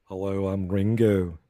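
low, slow Liverpool impression; slow, deadpan mock Liverpudlian. Shown as a demonstration. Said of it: "here's my bad [name]".

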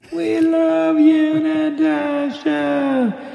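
slow, high, stretched-out yell; long yell. Retold or imitated. Scouts yelling to the girl at morning assembly, drawn-out holler. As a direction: holler it across the valley, dragging every word. Dad retells the second yell.

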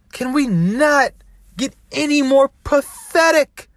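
high, loud, drawn-out disbelief; slow and shouting, disgusted; raging at parents chasing likes; passionate outraged yell. Real, not acted. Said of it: "very passionate about this stuff".

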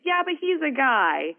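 high, drawn-out mock excuse; dismissive uncle. Uncle excusing himself to his niece, mock dismissive. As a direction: imitate an uncle brushing off the question.

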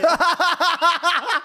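high, bright burst of laughter. Real, not acted. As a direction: laugh out at your own joke. Laughing at own joke.

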